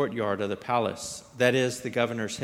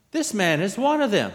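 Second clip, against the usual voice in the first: raised, louder accusation; accusing; 8 semitones higher, 6 dB louder than usual. A narrator's reading. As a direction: point him out to everyone, higher and louder. Servant girl accuses Peter to bystanders.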